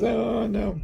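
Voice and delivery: high-pitched worried oh no; a high, alarmed oh no. Retold or imitated. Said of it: "Constance goes".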